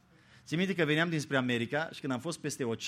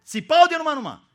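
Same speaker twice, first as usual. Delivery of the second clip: „țipau” shot out much higher and louder; mock screaming; 12 semitones higher, 13 dB louder than usual. Shown as a demonstration. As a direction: scream out „țipau de numai numai” as the panicked fishermen did. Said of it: "cam ce-o fi fost acolo". („țipau” strigat tare și sus)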